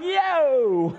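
long, high sideline holler; excited yell. Retold or imitated. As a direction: holler at a near goal, then reel it in. Acts out near-goal yell.

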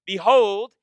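loud pointed behold; a hint of a scandalized Pharisee; mock Pharisee accusation. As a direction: announce it like catching a culprit. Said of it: "they say".

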